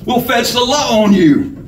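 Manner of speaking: high, feebly defiant threat; indignant victim, pitched high; weak courage. A narrator's reading. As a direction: threaten with what little nerve you have. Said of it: "showing a weak flame of courage".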